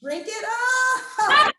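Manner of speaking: high, loud laughing words. Shown as a demonstration. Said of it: "laughing while demoing".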